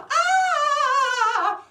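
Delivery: long, very high, loud scream. A narrator's reading. Girl and hunter both scream at the wolf, screaming. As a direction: hold a drawn-out shriek. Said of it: "they're both screaming".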